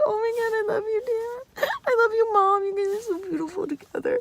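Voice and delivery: high, soft tearful gush; touched crying. Retold or imitated. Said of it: "impacted my heart".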